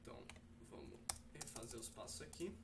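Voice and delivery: low, soft voice while working; halting, hushed talk to oneself; absorbed self-talk. Real, not acted. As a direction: think aloud quietly while fixing a mistake. Fixing his own error while recording.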